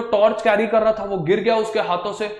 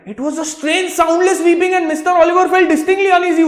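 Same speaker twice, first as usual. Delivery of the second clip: higher, slow, spooky storytelling; eerie narration; 9 semitones higher, 5 dB louder, much slower than usual. A narrator's reading. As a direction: draw them into the creepy moment. (रहस्यमय अंदाज़ में)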